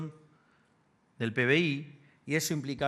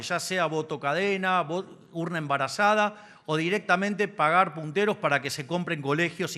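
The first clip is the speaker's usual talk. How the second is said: booming balcony speech; 4 semitones higher, 4 dB louder than usual. Acted. Campaign speech before the lecture.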